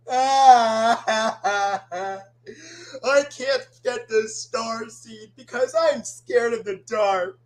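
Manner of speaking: cartoon bawling into a tearful confession; acted crying. Crying character admitting fear of the dark, mock sobbing. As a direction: wail like a scared crying character. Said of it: "Ord, please stop crying".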